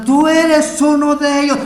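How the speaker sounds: high, forceful accusing shout; acted confrontational yell; loud accusation. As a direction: accuse him publicly at full volume.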